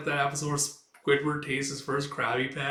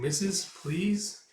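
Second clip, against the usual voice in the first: slow, higher, drawn-out plea; pleading child; 6 semitones higher, much slower than usual. Acted. Boy begging the Missus to go, slow and high. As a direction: beg sweetly, stretching the please.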